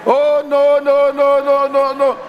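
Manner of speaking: high, loud, repeated no; loud protest. Acted. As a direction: cry out no again and again. Preacher protests in mock alarm.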